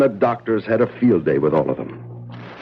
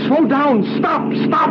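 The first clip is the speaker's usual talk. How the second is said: retold scream; slower than usual. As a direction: shout after the speeding car. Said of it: "I screamed at him".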